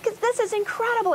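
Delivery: high, eager, breathless wonder; thrilled exclaiming. Retold or imitated. Telling viewers how she reacted at the well, high and thrilled. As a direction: sell it like you can't believe your eyes.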